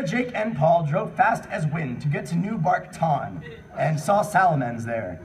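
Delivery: normal talking voice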